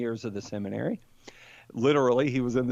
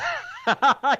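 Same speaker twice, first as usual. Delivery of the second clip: bright high laugh, sudden; high burst of laughter; 11 semitones higher, 6 dB louder than usual. Real, not acted. Laughing at host's "I was three" joke, burst of laughter.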